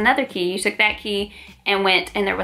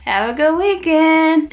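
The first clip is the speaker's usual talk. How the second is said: ominous farewell; 7 semitones higher, 4 dB louder, slower than usual. Retold or imitated. Nurse says ominous goodbye.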